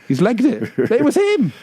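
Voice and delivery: rising to a high-pitched punchline; acted astonishment. Actor seeing the troublemaker flee, hammed-up astonishment.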